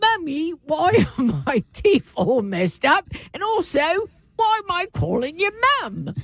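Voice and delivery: put-on high-pitched whiny-kid act, British accent; British child. Child asks mum about teeth, mock British accent. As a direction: play a puzzled British child.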